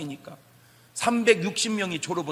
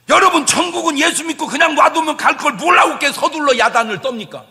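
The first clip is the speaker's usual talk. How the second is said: full-force preaching; 6 semitones higher, 11 dB louder than usual. Acted. Pastor urging the congregation to stop struggling, booming preaching. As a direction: preach it hard, pounding each 놔둬.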